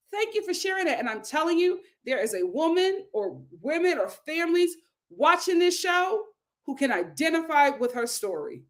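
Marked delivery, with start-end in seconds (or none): none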